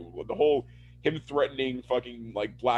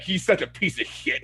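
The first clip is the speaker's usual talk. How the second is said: ordinary talk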